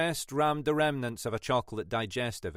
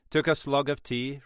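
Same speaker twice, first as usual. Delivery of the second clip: plain reading voice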